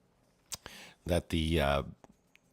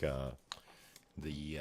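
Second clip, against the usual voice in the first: ordinary talk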